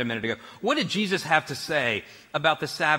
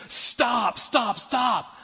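high-pitched mock scream to halt someone; imitated yelling; 8 semitones higher, much slower than usual. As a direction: imitate a frantic yell from the front door. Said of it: "yelling, screaming at me".

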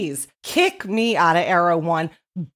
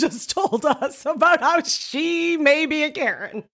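ordinary talk

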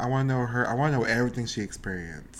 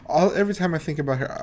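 ordinary talk

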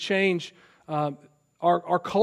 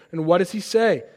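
usual speaking voice

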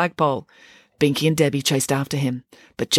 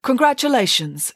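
formal, high-pitched congratulation; ceremonious and pitched up; 8 semitones higher than usual. A narrator's reading. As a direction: offer it formally, like a princess presenting a cup. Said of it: "she said formally".

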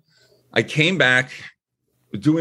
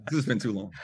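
stuffy nose from allergies; congested, nose being blown; blowing nose; 2 semitones lower, 8 dB quieter, faster than usual. Real, not acted. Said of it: "when I keep blowing my nose".

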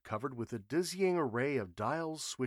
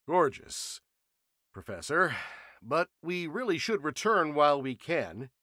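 higher, louder anxious urging; worried colleague; 5 semitones higher, 7 dB louder than usual. A narrator's reading. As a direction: gently press him to turn back. Matthews voiced, worried plea.

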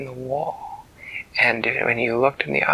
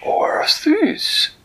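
higher, eager cat-king voice; a delighted, fussy creature greeting a friend; Flibbertigibbet's voice; 4 dB louder than usual. Acted. Storyteller voicing Flibbertigibbet greeting Winella, high and delighted.

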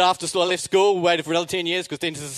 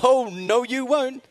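high-pitched comic defiance; mock-indignant objection; defiant refusal; 7 semitones higher, much slower than usual. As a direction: refuse the dentist outright, pitch climbing. Defiant refusal of root canal.

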